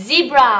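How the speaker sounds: "Zebra" with a buzzed Z, never an S; emphasised Z. Shown as a demonstration. Mommy corrects the first sound, buzzed Z in "Zebra". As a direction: lean on the voiced Z that opens "Zebra". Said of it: "เน้นไม่ใช่ C นะคะ มันเป็นตัว Z".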